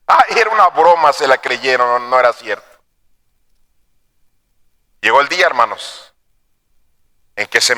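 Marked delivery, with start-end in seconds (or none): none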